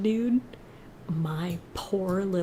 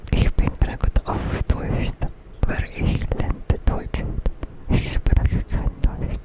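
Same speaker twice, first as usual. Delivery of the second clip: ASMR whisper; faster than usual. Real, not acted. Streamer whispering Duolingo to chat, ASMR whisper. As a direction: whisper it close and intimate for chat.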